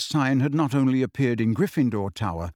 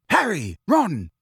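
high, urgent hail; raised-pitch shout to catch their attention; calling out; 7 semitones higher, 4 dB louder, slower than usual. Acted. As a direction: call out each name sharply from a distance. Angelina hurrying over, calling them.

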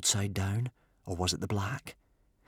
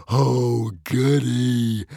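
louder, gleeful mock delight; grinning glee; 3 semitones higher, 7 dB louder, much slower than usual. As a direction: relish his misfortune like a treat. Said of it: "grinned Norbert the Nutjob".

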